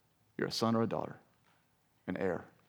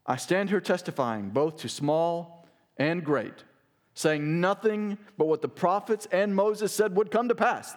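raised, ringing scripture reading; fervent preacher reading Paul's words; preaching at full force; 5 semitones higher, slower than usual. Acted. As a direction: proclaim it to the king. Preacher reads Paul's defense.

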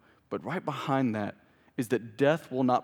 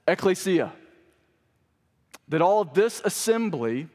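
higher, emphatic scripture proclamation; preaching; 4 semitones higher, 3 dB louder than usual. Acted. Preacher declaring David's words to the assembly, emphatic preaching. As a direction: proclaim each phrase with weight.

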